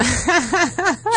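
high giggle; real high laugh; burst of laughter. Real, not acted. Laughing at her own joke.

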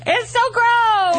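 high, drawn-out squeal of disgust; amused disgust. Real, not acted. Guest heckling host's pouty accent, grossed out. Recoiling from the impression.

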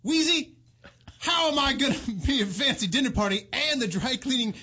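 hammy, exasperated complaint to a wife; put-on celebrity impression, indignant; Jefferson. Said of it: "How about Jefferson".

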